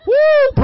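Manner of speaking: a loud, high whoop; loud woo. Real, not acted. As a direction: whoop with joy. Congregation excitement.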